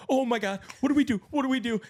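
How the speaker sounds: high, loud frantic what do we do; panicked shriek. Retold or imitated. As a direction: squeal in helpless panic. Panicking over the possum.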